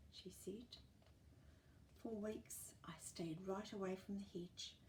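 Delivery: soft sad whisper. A narrator's reading. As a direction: whisper it sadly.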